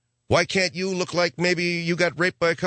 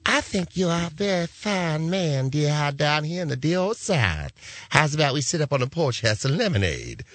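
fake Southern belle. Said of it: "hear Nicole Kidman going".

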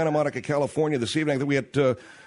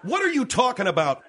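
exasperated; 6 semitones higher than usual. Real, not acted. Exasperated by demand for slower.

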